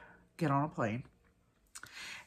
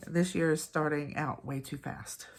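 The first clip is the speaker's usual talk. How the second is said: ordinary talk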